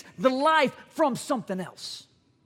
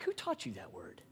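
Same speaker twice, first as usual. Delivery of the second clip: far lower, softer mock-gruff voice; mock stern dad; 16 dB quieter than usual. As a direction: growl it as the shocked father. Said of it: "I'm like".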